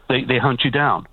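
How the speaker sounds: ordinary talk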